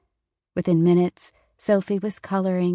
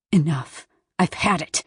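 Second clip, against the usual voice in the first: louder exasperated mother; yelling; 5 dB louder than usual. A narrator's reading. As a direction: snap at the kids, fed up. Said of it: "she yelled".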